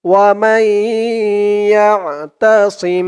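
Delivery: slow, drawn-out chanted recitation; Quran recitation. Shown as a demonstration. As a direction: recite it slowly, stretching each vowel. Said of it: "recites the example".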